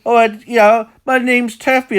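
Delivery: Welsh accent impression, high and loud; Taffy. Shown as a demonstration. Man doing a Taffy impression for everybody, high mock-Welsh accent. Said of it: "Just doing a, uh, Taffy impression".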